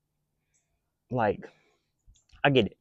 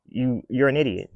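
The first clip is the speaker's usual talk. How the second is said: normal talking voice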